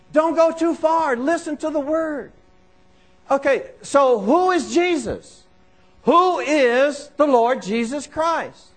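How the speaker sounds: high, insistent pulpit delivery; forceful preaching. Real, not acted. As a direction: preach it with urgent, punched repetition.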